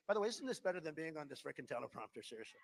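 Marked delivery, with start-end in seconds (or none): none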